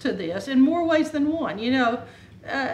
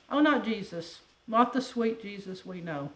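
normal talking voice

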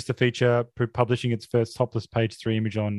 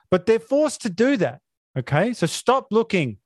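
mock outraged rant; 6 semitones higher than usual. Parody rant builds higher.

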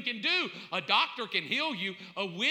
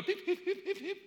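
high, quiet heart-monitor beeps; imitated monitor beeps; 8 semitones higher, 4 dB quieter than usual. Preacher acting out his own deathbed, imitated monitor beeps. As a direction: beep it out like a hospital monitor.

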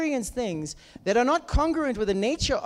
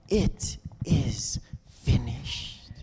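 whisper; 11 semitones lower, 4 dB quieter, much slower than usual. Acted. Whispered climax of preaching.

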